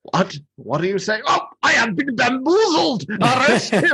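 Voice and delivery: over-the-top outrage, climbing very high; puzzled, then explode into high indignation; Burgermeister. Acted. Burgermeister realising he was bamboozled, high hammy cry. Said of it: "how over the top the Burgermeister is".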